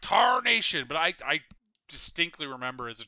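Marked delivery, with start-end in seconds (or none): 0.0-0.9 higher, louder mock exclamation; imitating someone shouting in exasperation; imitated shout. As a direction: call it out like a startled Southern shout. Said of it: "heard somebody shout".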